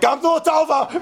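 high call of "Gamzu Latova!"; echoing call. Retold or imitated. Neighbor echoing the shout to the plane. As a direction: echo the shout back. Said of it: "So the person next to him, like".

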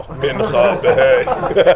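very drawn-out call with laughter; calling out prayer. Pretend. Rabbi jokingly announcing afternoon prayer, laughing drawn-out call.

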